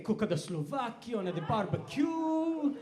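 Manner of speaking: lightly put-on, failed Greek accent; badly faked Greek accent. Fake Greek who can't speak the language, bad Greek accent. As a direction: attempt a Greek accent and miss. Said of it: "I can't even do the accent".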